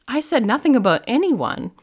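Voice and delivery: high, loud and defensive; indignant protest. Retold or imitated. Daughter denying mom's guess on the phone, indignant protest. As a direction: act out protesting a wild assumption.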